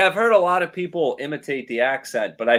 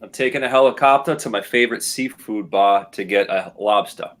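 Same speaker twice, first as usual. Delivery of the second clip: lower-pitched native Rhode Island accent; 4 semitones lower than usual. Shown as a demonstration. Local champ reads the game sentence, Rhode Island accent. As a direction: read it in natural Rhode Island speech. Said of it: "we'll say it one more time".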